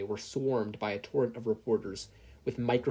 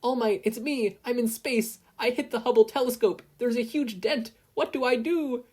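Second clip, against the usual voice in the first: put-on much higher, frantic boy; panicked; 12 semitones higher, 5 dB louder than usual. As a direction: panic over the phone from space. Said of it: "Izuku panicked".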